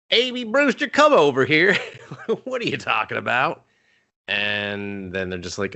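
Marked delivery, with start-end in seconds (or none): 0.1-3.6 high, sing-song mom voice calling from the couch. Retold or imitated. Mom on the couch calls out to Amy, high and sing-song. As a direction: play the mom hollering for her son's girlfriend. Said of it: "his mom's just like".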